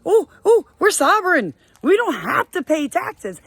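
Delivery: high, loud mocking whine; mocking sovereign citizen. Mocking the sovereign citizens to her viewers, in a high whine. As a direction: mimic them as silly and self-important. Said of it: "You sound absolutely insane".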